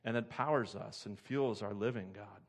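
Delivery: closing prayer, softening toward the amen. Acted.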